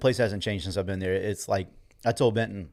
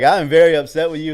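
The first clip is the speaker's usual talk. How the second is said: higher and louder playful scolding; mock upset coach; 6 semitones higher, 9 dB louder than usual. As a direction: pretend to be hurt and angry, loudly. Old coach playfully scolding him.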